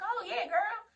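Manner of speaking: high-pitched imitation of instructor; squeaky mock agreement; retold breezy reply. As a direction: pitch it way up, teasing.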